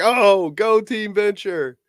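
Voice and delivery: high, drawn-out mock cheer; excited fan. Co-host recalling his reaction to hearing Action Man, excited cheer. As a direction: re-enact a giddy fan's cheer. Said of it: "I got so excited".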